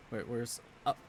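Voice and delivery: ordinary delivery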